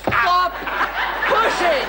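pained yelp. Acted. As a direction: yelp in pain, drawing it out. Foot caught under couch.

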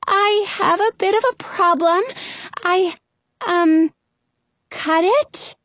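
high, halting confession, "cut it?" lifted into a question; hesitate, then ask "cut it?" as if unsure; "cut it?" tentative, high, rising at the end. A narrator's reading. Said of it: "says it like a question".

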